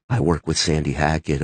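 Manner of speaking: very low, subdued monotone; meek reply. Retold or imitated. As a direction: answer small and low under questioning. Meek answer to intimidating star.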